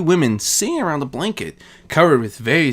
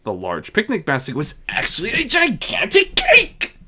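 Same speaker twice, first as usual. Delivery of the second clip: excited; 8 semitones higher than usual. A narrator's reading. Narrator exclaims discovery.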